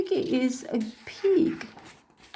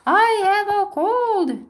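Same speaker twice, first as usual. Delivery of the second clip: higher and louder, bursting with relief; overjoyed; 8 semitones higher, 4 dB louder than usual. Acted. Gerald calling to Piggy, thrilled that it is only a cold. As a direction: announce it like the best news ever.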